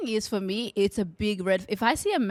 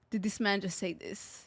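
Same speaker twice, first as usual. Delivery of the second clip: stunned quiet; 3 semitones lower, 9 dB quieter than usual. Retold or imitated. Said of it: "my response would be freeze".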